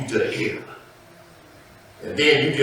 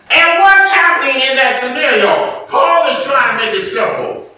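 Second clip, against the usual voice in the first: loud, raised-pitch sermon exhortation; preaching at full force; 12 semitones higher, 9 dB louder than usual. Real, not acted. Preacher warning his people against added rules, forceful preaching. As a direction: drive it home to the congregation.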